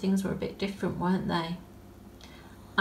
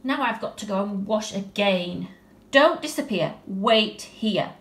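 put-on bossy little girl; 9 dB louder than usual. Tilly tells the bear to wait, bossy command. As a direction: order the bear to stay put.

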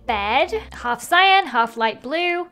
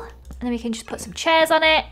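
ordinary talk, nothing marked

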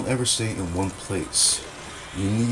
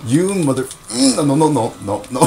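frantic yelling; 6 semitones higher, 6 dB louder than usual. Real, not acted. Being killed in match.

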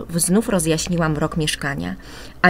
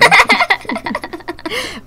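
high, eerie cackle; ghoulish laugh; 4 dB louder than usual. Acted. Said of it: "zaśmiał się upiornie". (wysoki, upiorny chichot)

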